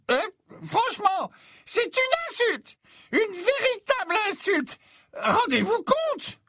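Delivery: very high, slurring tipsy professor; drunken indignation. A narrator's reading. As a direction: rant boozily, dragging words, squeaking with outrage. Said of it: "semblait trop éméché".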